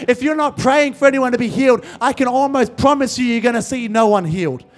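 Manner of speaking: emphatic, driving preacher cadence; fervent preaching. Real, not acted. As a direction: drive each phrase home to the congregation. Preaches at full force.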